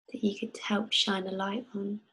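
whispered question to the oil. Acted. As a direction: breathe the question like a secret.

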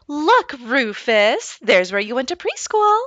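put-on a mother showing her son his old school; lightly voiced mom. Mom points out the old preschool to Rufus, light motherly voice.

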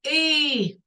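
a long, held "и"; isolated vowel sound. Shown as a demonstration. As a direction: sustain "и" by itself. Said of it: "звук и".

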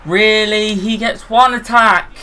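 high, loud outraged question; indignant disbelief. Real, not acted. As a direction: protest in disbelief. Opponent scores again.

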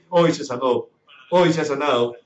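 usual speaking voice

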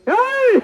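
high, drawn-out "ey!" to the bulls; urging cry. Real, not acted. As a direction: whoop high to drive the bulls on. (ఎద్దులను అదిలించే కేక)

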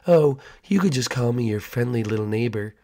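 creepy neighbor. Acted.

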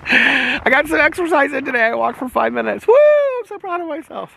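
high, gleeful boasting with a whoop; self-congratulating cheer. Real, not acted. Vlogger cheers her five-minute walk, high mock-triumphant glee. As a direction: celebrate a tiny feat in a high squeal.

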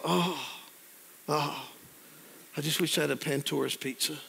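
soft, mock-wistful hinting; quiet, put-on longing; passive-aggressive wishing. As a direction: imitate a wife sighing a hint for food. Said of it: "this passive aggressive thing going on".

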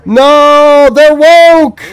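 hammed-up horror at the game; playful indignant shout; mock outrage. Real, not acted. Game calls his speech prejudiced.

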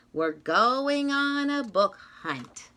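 ordinary delivery, nothing marked

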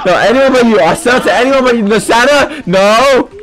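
high, urgent mock-panic protest; panicked refusal. Seller refusing Santa in the game, high panicky yelling. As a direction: cry out against the buyer.

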